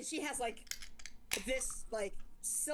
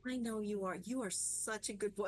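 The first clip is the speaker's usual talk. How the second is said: usual speaking voice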